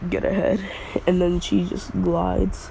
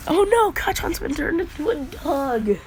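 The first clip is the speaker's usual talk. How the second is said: much higher, hammed-up alarmed voice; mock panicked Deku; 10 semitones higher than usual. Deku fake-panicking that his friend became a dog, high squeak.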